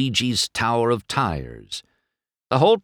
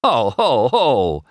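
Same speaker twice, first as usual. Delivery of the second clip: louder, mocking acted laugh; cracking up; 4 semitones higher, 6 dB louder, slower than usual. A narrator's reading. McQueen scoffs at Doc's challenge, cracking up. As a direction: hoot it out in big separate hos. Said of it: "McQueen cracked up".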